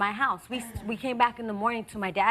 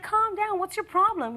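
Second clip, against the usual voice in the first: higher-pitched retold comeback; retold protest; 9 semitones higher, slower than usual. Litigant retelling her reply to the driver, mild protest. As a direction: quote yourself telling him off, pitched up. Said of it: "I'm like".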